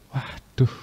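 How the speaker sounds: normal talking voice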